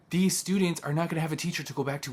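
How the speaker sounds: normal talking voice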